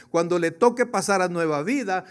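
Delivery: usual speaking voice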